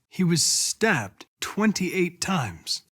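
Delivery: ordinary talk, nothing marked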